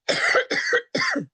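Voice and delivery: throaty coughing fit; real cough. Real, not acted. Presenter breaks off talk with a cold, real coughing fit. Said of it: "I'm just getting over a cold".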